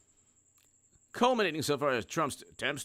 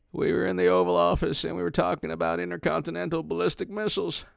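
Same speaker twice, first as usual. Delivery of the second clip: Bill Clinton voice; 6 semitones higher, 4 dB louder than usual. Retold or imitated. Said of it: "I was asked to do my Bill Clinton voice".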